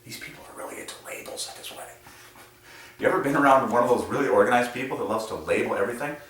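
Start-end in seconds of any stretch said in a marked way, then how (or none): 0.0-1.8 whispered, fast wedding-guest aside; mock whispered aside. Wedding guest muttering to a neighbour, whispered. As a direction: whisper it quickly behind a hand. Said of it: "You're like".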